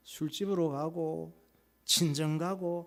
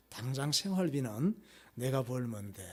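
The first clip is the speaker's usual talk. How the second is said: gentle wife; 3 semitones lower, 4 dB quieter than usual. Acted. Ideal wife comforting husband.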